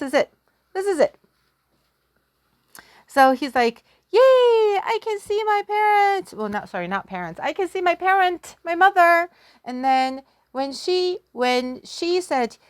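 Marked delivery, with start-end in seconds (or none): 4.1-6.3 excited son. Acted. Son sees parents, excited. 7.4-9.3 excited son. Acted. Son excited to see mother.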